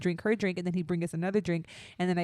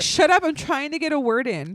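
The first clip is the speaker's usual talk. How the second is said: mock annoyed; 6 semitones higher, 7 dB louder than usual. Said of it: "It's like".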